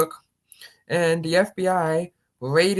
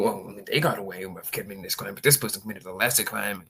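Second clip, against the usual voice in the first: lower, softer grumbling excuse; mocking whataboutism; 9 semitones lower, 4 dB quieter than usual. Parodying someone excusing their side, lower and softer. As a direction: sulk it out like a kid caught.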